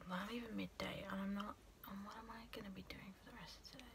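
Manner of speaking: hushed whisper aside. Real, not acted. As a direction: whisper it to yourself, fast.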